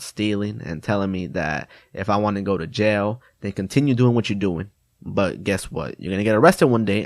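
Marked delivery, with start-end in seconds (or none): none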